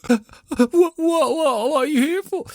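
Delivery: high, wailing panic, hammed up; flustered squeak. Ben asking what they are here for, panicked. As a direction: stammer it out in a squealing falsetto. Ben answering the demanding listeners.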